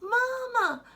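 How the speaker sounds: put-on high, loud child calling Mama. Boy bounding downstairs calls his mother loudly. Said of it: "he called".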